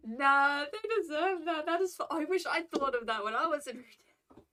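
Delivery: loud, high, excited outburst; delighted approval. Real, not acted. Streamer cheering on the retail prank, delighted. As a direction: burst out in gleeful endorsement.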